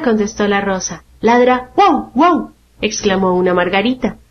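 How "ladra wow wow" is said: imitated barking. A narrator's reading. A daisy shows how the guayabo barks, imitated barking. Rose says the tree barks.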